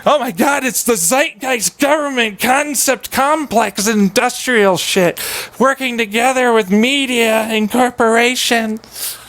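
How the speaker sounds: high, drawn-out parody voice; mock conspiracy alarm. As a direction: stretch it out high, like a fake revelation.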